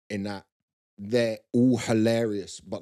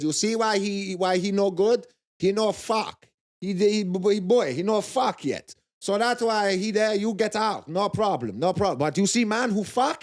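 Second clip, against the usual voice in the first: loud, higher Brazilian accent, imitated; Brazilian coach accent; 10 semitones higher, 5 dB louder than usual. Coach mocking a sixteen-year-old trainee, loud Brazilian accent. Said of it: "He goes".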